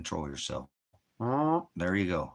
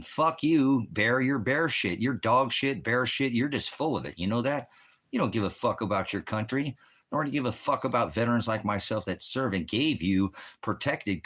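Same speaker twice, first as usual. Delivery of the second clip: higher, heated cursing; real angry outburst; 5 semitones higher, 3 dB louder than usual. Real, not acted. Streamer tearing into a commenter over his country, angry. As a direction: hurl the insults with raised pitch. Livestreamer curses out chat commenter.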